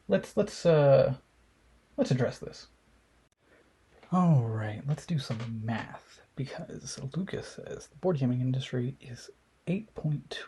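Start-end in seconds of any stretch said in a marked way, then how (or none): 4.9-10.4 soft whispered aside; quiet unvoiced whisper. Real, not acted. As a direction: drop to a whisper to start the sums. Whispered aside doing math.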